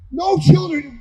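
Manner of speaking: ordinary talk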